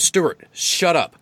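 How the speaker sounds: high, slow snake voice snapping shut up; irritated snake telling the eagle off. Acted.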